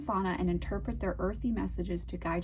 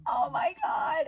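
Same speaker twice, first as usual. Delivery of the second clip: high, slow squeal of delight; excited shout; 10 semitones higher than usual. Real, not acted. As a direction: squeal it out, thrilled. Thrilled by bat on card.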